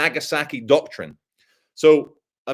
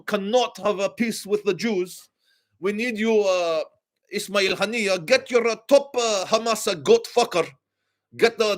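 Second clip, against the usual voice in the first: higher mock foreign voice; lightly voiced Iranian official; 5 semitones higher than usual. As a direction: voice the Iranian sender, mildly put on. Iran's message voiced.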